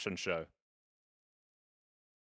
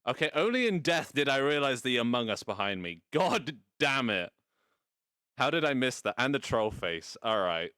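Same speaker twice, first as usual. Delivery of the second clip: loud, higher-pitched gamer frustration; exasperated outburst; 4 semitones higher, 5 dB louder than usual. Real, not acted. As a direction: raise your voice, annoyed at your own blunder. Dies in game, missed what was behind.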